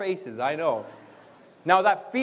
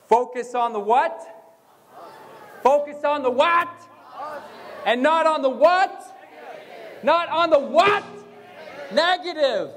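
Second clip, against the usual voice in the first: call-and-response prompt; 7 semitones higher than usual. Real, not acted. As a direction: shout it out for the audience to finish. Prompting audience to shout back.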